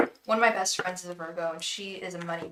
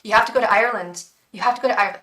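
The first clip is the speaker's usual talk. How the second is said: put-on fast, louder, deliberately terrible Irish accent on "You have to go to Ireland"; hammed-up mock Irish brogue; bad Irish accent; 2 semitones higher, 8 dB louder, faster than usual. Beckett's spirit urging her to Ireland, in a terrible Irish accent. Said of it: "the worst Irish accent".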